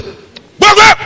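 high, loud order to a dog; shouted command. Acted. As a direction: chase the dog away.

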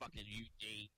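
slow wrestler promo impression; Freight Train. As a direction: finish the mock threat in character. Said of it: "I got Freight Train for you".

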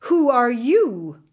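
loud, slow challenge; demanding question. A narrator's reading. Wazir's sons confront the unknown prince, demanding loudly. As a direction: demand it slowly and forcefully.

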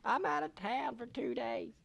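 high, drawn-out mock female voice; imitated ex-girlfriend. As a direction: mimic an annoyed ex, slowly and high-pitched. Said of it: "she's like".

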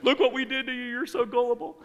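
loud, high-pitched taunting imitation of family; hammed-up sing-song ridicule; mocking. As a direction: tease like a jeering relative. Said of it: "terribly laughed at and mocked".

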